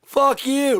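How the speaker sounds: high, loud snarled curse; angry yell. Acted. Ranting patriot yells at his critic. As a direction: spit it out as a furious yell. Mock-patriot rant, cursing.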